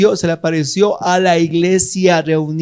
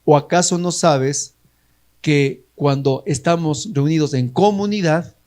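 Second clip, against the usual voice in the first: ordinary talk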